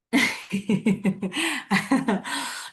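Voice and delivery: real amused chuckle at her own story; laugh. Real, not acted. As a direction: let a small laugh slip out.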